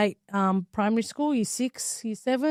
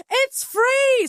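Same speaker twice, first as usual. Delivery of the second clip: high, slow and drawn out; squealing that it's free; kids' excited squeal; 5 dB louder than usual. Retold or imitated. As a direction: play the thrilled little kid.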